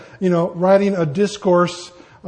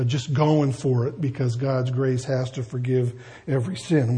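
ordinary talk

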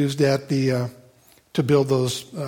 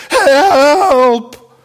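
high, loud, drawn-out wail; hammed-up desperate yell for rescue; cry for help; 10 semitones higher, 8 dB louder than usual. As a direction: holler it long and high. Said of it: "It's kind of the equivalent of".

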